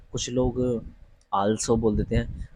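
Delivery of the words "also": "also" with an open AAL; long "aa" vowel at the start of "also"; common mispronunciation. Shown as a demonstration. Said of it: "ना ही also है".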